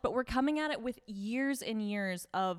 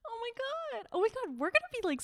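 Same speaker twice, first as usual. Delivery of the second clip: high, giddy squeal; excited squeal. Real, not acted. Excited by the Instagram handle.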